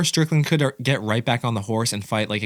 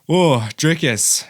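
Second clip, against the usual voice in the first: gushing; 5 semitones higher, 4 dB louder, much slower than usual. Real, not acted. Gushing over his favourite fighter.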